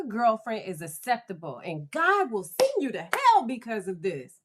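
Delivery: preachy, high swooping rebuke; scolding religious mother. Retold or imitated. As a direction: lecture it like a mother condemning sin. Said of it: "Here's what this one was doing".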